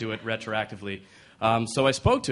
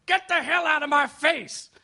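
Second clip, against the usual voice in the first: put-on angry old man yell. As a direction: snap at him like a grumpy old man. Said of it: "And the man says".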